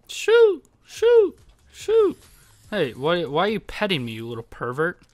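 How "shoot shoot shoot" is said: high whistly "shoo" sounds, repeated; mouthed gunshot noises. Pretend. Gamer mimicking shots in play, mouthed gunshot noises. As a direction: imitate gunfire with your mouth in high bursts.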